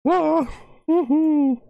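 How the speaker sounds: very high falsetto "ah" and "woohoo"; high whoop. Real, not acted. Streamer celebrating the nearly finished download, high whoop. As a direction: cheer in a squealed falsetto. Cheering that download is almost done.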